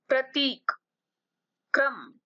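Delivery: ordinary talk, nothing marked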